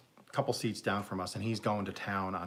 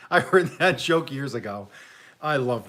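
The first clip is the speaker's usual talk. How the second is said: laughing; 4 semitones higher, 9 dB louder than usual. Real, not acted. Laughing after joke.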